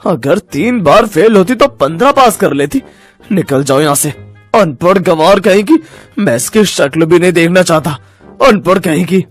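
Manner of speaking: scornful, furious husband; mock her and throw her out; angry contempt. Enraged husband insults and rejects wife. (गुस्से और तिरस्कार से)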